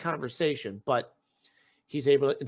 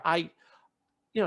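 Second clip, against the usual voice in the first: normal talking voice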